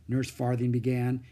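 plain reading voice

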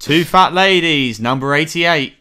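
loud, slow bingo call; bingo caller. Pretend. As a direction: call the number like a bingo hall. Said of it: "bingo announcer".

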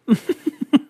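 high, loud put-on laugh; mock laughing. Shown as a demonstration. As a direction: show how you'd laugh in their face. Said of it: "I'm just gonna laugh".

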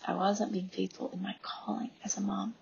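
breathy whisper, voice dropped away; whispered confession. Real, not acted. Mom admitting to listeners she failed her calling, whispering. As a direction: confess it under your breath.

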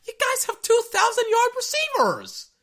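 very high-pitched disbelieving yell; real squealed frustration; exasperated outburst. Real, not acted. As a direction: cry out at the absurdity of it.

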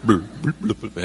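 low, burbling fish noises; deep bubbly fish babble; fish talk. Pretend. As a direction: gurgle like a little fish underwater. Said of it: "el otro le contesta".

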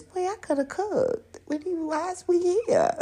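high, indignant complaining about the menu; mock outraged diner. Imitating herself at restaurant.

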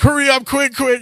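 high urgent yell, hurry up, quick; frantic shout. Retold or imitated. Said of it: "they're like".

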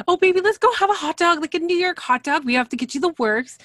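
high, loud, fast gushing; excited Darcy. Retold or imitated. Mimicking Darcy pushing hot dogs on Florian, high and fast. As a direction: rattle it off, bubbling over.

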